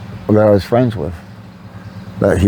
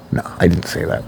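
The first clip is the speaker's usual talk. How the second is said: ordinary delivery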